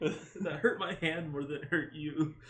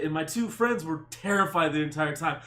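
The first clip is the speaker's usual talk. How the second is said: normal talking voice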